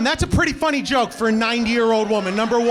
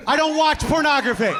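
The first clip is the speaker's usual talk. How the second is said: mock-innocent married guy shouting his denial; lying husband; 8 semitones higher than usual. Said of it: "What you do is this".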